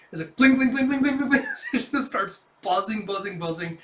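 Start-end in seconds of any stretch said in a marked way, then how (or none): 0.4-1.5 high, loud sing-song bling noises; mock ringtone bling; imitated notification chime. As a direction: chime like a flooded phone.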